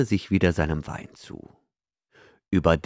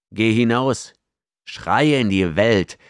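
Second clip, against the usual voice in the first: taunting challenge; 5 semitones higher, 6 dB louder than usual. A narrator's reading. Outlaw taunts the slave.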